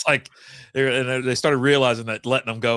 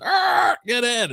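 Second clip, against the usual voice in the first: much higher, loud barked order; gruff shout; 11 semitones higher, 4 dB louder, much slower than usual. Retold or imitated. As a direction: yell at them to get in the car. Shoving them into the Beetle.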